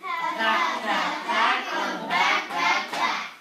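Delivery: teacher cheering the class; cheering pat pat. Shown as a demonstration. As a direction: cheer the kids on. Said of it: "give yourselves a pat".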